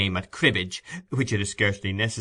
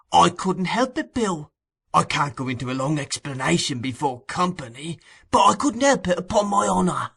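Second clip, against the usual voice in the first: put-on higher, pleading old man's voice; 7 semitones higher, 3 dB louder than usual. A narrator's reading. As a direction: beg off like a cringing old miser.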